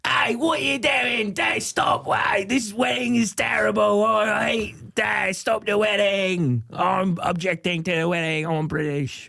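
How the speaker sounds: unruly British wedding heckler, high-pitched; bad British accent. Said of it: "I'm an unruly, uh, British guy".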